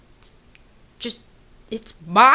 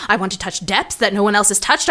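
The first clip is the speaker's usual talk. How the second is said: usual speaking voice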